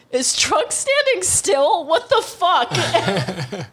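high, drawn-out incredulous question; slow, comic exasperation; mock disbelief. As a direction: act out baffled disbelief, half laughing. Acts out Goku baffled by Trunks.

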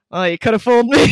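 mock the claim with a dry quip; sarcastic boyfriend. Chris teasing her after two breakdowns, loud sarcastic quip. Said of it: "Chris literally goes".

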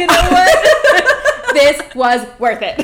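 high, loud laughing quote; laughing hard. Retold or imitated. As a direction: laugh through the words. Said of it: "They were like".

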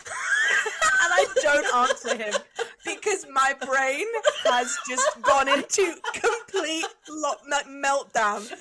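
loud, slow, laughing through the story. Real, not acted. Friend telling a work story, laughing loudly and slowly. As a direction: break up laughing while telling it. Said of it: "complete meltdown".

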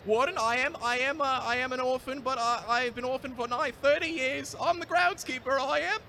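high, put-on orphan voice in a bad accent; Victorian orphan. Groundskeeper stammering to the warden in a high orphan voice. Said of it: "really bad accent".

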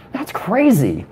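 rising "What?" then slow sighed "That's crazy"; mock amazement to keep them talking; fake shock. Shown as a demonstration. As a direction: fake being stunned, then sigh. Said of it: "you say".

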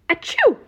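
big acted high sneeze; a huge cartoon sneeze; loud sneeze. Badger sneezing into the night, loud and high.

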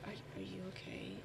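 very soft, low, hesitant whisper. Retold or imitated. Alana checking whether Val is okay, whispering. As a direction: whisper a careful check-in, imitating a friend. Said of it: "she's, like, whispering".

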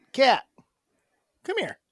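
calling a cat. Real, not acted. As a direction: coax the cat over sweetly.

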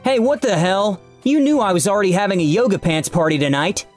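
high-pitched mock yell; indignant shout. A narrator's reading. Supervisor objecting over the yoga pants party, high indignant shout. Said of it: "Jacob's supervisor shouted".

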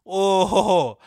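loud amused "ohhh"; scandalized "oh-ho-ho". Real, not acted. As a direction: react gleefully to a savage insult. Mock reaction to name-calling.

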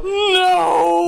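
high, drawn-out cry of no; exaggerated high moan of dread; mock despairing wail. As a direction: wail it in comic horror.

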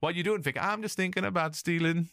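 voce più alta per imitare il personaggio; imitating her. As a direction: recita la battuta di lei, un po' più acuta. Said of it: "And she's like".

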